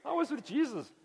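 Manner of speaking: high, boastful mock brag; self-important people boasting of being near Jesus; bragging follower. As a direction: show off, way up high. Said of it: "They're like".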